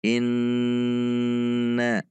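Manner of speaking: "Inna" with a long humming nn; "Inna" dengan dengung panjang; nasal ghunnah. Shown as a demonstration. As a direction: hold the nasal in "Inna" for two beats. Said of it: "ada dengung dua harokat".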